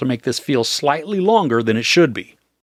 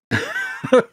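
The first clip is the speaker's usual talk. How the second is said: disgusted laugh. Real, not acted. Reacting to tasting the chips.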